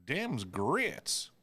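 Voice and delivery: lightly voiced Southern waitress stating the obvious. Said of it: "she goes".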